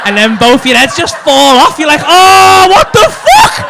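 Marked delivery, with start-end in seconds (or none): 2.0-3.7 loud high mock freak-out; shocked shout. Stoned student shouting in shock as minds are blown. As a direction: yell as if your mind just blew.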